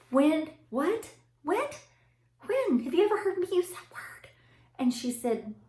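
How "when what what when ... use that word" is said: high-pitched startled questioning; alarmed disbelief. Retold or imitated. Mom asking where her child heard that word, high alarmed disbelief. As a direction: fire off rising, incredulous questions.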